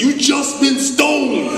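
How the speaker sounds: high, slow, drawn-out taunt; sister's taunt. Retold or imitated. Sister stands up in the stands, gloating. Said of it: "his sister stood up and said".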